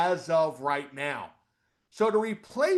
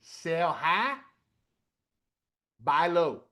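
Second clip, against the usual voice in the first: Southern accent; much slower than usual. Shown as a demonstration. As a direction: say it like a Southern trader. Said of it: "say this in a Southern accent".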